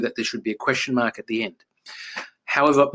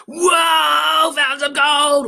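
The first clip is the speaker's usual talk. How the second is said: high, loud thrilled whoa; overjoyed discovery shout; excited shout; 6 dB louder, much slower than usual. Shown as a demonstration. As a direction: yell it like you struck it rich. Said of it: "Or are they gonna say".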